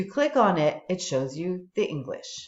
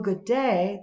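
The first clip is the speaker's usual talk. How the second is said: usual speaking voice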